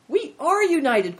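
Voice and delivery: loud, high-pitched cry; eager cry. A narrator's reading.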